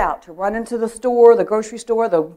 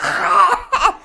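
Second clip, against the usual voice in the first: loud, high gagging noise; imitated retching; 12 semitones higher, 5 dB louder than usual. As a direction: gag noisily as if making yourself throw up. Finger down throat, then threw up.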